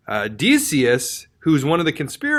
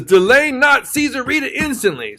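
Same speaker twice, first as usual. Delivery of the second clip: higher and louder, urgent insistence; frantic demand; 9 semitones higher, 3 dB louder than usual. Retold or imitated. Petitioner frantically insisting Caesar read now. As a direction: demand it right now, almost unhinged. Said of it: "homeboy is psycho".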